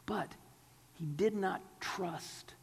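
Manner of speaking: slow, whispered emphasis; slow whisper for weight. Real, not acted. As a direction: drop to a hushed, grave whisper. Preacher drops to whisper for emphasis.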